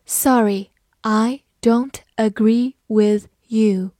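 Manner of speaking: slow, careful word-by-word read. Shown as a demonstration. Teacher reading the example sentence slowly to students. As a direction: read it slowly so learners can follow. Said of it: "好，慢慢来读".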